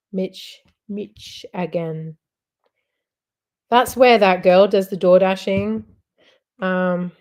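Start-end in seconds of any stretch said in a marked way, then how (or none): 0.1-2.2 "Mitch again" said slowly, broken into pieces; sounding out Michigan. Shown as a demonstration. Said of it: "Look at my pronunciation".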